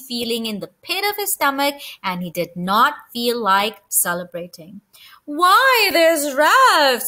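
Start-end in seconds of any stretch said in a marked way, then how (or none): 5.2-7.0 high, loud squeaking greeting; squeaky aunt. A narrator's reading. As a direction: squeak it out like a showy mouse aunt. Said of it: "squeaked his Aunt Sissy".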